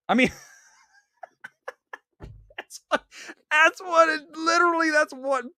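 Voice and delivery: laughing so hard words barely come out; real giggling fit; helpless laughter. Real, not acted. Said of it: "I can't stop myself".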